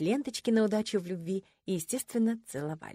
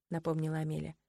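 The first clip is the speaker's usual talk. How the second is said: usual speaking voice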